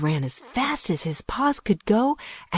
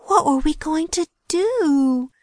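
ordinary delivery, nothing marked

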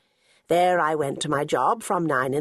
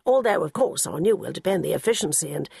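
put-on grand old gentleman; 3 semitones higher than usual. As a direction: puff up the group's importance. Narrator voices Sir Quentin.

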